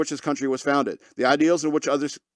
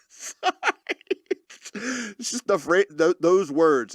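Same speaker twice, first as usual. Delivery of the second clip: ordinary talk, nothing marked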